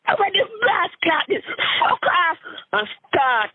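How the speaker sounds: breaking into a high giddy squeal; overjoyed, nearly laughing; excited high squeal. Real, not acted. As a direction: shoot way up in pitch with glee. Cracks up telling story.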